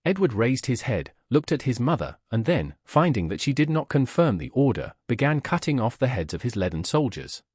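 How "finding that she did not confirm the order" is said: usual speaking voice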